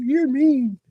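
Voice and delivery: high, sulky mimicry of her; mocking whiny quote. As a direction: whine it like a wounded accuser. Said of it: "She's like".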